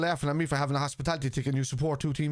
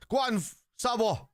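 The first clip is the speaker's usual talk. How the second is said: cheering; 8 semitones higher than usual. Real, not acted. Cheering a player on.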